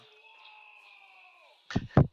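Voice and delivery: high, loud shrieking laughter. Real, not acted. Laughs about the visor.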